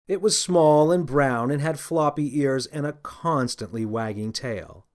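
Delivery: plain reading voice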